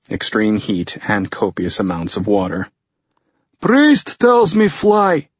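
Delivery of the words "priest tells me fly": put-on high, slow Russian-accented broken English; strained high voice with a thick Russian accent. A narrator's reading. Fyodor tells Tucker about the flight, in Russian-accented broken English. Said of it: "added in badly broken English".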